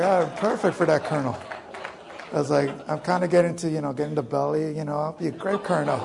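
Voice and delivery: high, loud, laughing boast; laughing joke. Real, not acted. Joking to the audience about being a fat colonel, loud and laughing. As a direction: laugh your way through the brag.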